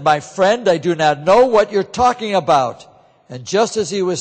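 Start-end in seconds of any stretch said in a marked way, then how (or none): 0.0-2.9 louder, higher flustered protest; mild desperate outburst; heated denial. Acted. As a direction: deny it hard, cornered and rattled. Peter's third, heated denial.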